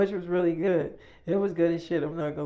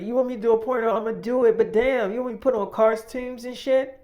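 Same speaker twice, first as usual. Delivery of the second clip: higher, louder retold objection; exasperated protest; 6 semitones higher, 6 dB louder than usual. Woman objecting to David's costume request, acted exasperation. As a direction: protest loudly in a raised pitch.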